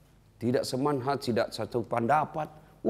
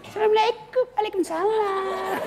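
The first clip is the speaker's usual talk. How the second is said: mock swooning voice. Preacher parodying a sweet-talking voice on the phone. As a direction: coo the greeting in falsetto. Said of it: "ada cowok juga yang begitu".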